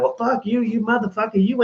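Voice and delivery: mock angry outburst. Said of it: "And suddenly it's like".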